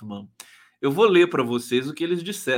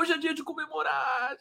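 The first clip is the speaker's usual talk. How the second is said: much higher, gleeful shout; celebrating; 10 semitones higher, 5 dB quieter than usual. Real, not acted. Host celebrating the politician's fall, high gleeful cry. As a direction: crow it out in triumph. Celebrating Dallagnol losing office.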